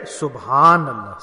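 SubhanAllah drawn out slowly and low. Shown as a demonstration. Preacher showing the congregation how to say it, slowly. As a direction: stretch SubhanAllah out, slow and settled.

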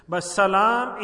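ordinary delivery

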